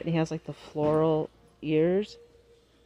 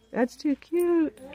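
much higher, drawn-out gush of delight; gushing squeal; 4 dB louder, much slower than usual. Real, not acted. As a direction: squeal it up high and slow, melting over it. Gushing over a gnome figurine.

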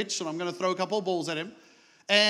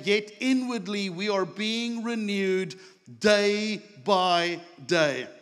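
preaching scripture; 4 semitones higher than usual. Acted. Pastor reads scripture at full force.

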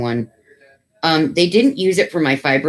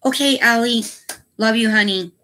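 affectionate; 9 semitones higher than usual. Real, not acted. Affectionate call to a viewer.

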